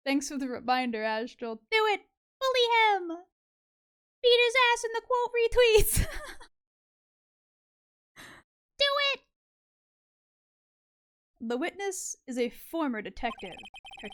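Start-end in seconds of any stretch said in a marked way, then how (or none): 1.6-9.2 high, loud gleeful cheering; excited shouting. Real, not acted. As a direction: egg the crowd on, laughing. Egging on chat to bully.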